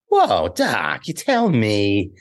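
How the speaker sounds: drawn-out Marty McFly impression; Michael J. Fox. Comedian doing his act for the actor himself, Marty impression. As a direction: stretch the words like an incredulous Marty. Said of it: "do an impression of me".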